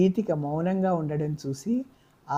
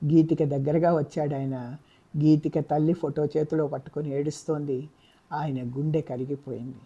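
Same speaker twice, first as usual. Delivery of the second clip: plain reading voice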